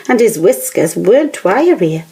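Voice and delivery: ordinary delivery, nothing marked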